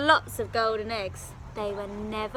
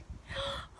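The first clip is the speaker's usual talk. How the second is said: sharp gasp; 9 dB quieter than usual. Acted. Giantess sees poor Jack.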